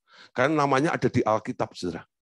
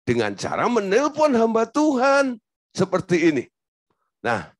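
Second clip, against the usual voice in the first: ordinary talk, nothing marked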